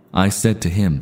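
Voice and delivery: ordinary talk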